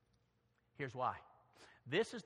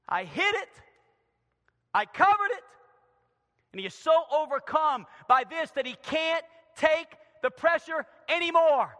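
loud, high, anguished pulpit delivery; full-force preaching; 10 semitones higher, 11 dB louder than usual. Acted. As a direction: build to a loud climax. Preaches David's confession.

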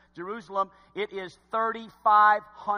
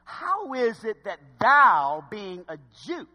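slow, pointed sarcasm with louder opening; sarcastic; 5 dB louder, slower than usual. Acted. Samaritan woman answering Jesus's request for a drink, sarcastic. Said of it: "so she gets kinda sarcastic".

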